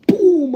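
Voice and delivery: loud, high imitated boom of a slammed car door. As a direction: make the door slam sound big.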